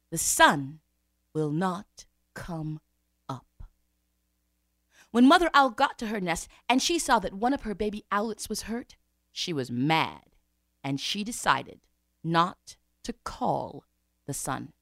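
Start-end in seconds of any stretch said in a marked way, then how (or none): none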